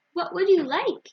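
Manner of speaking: grocer's greeting. A narrator's reading. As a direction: sing it out like a friendly grocer.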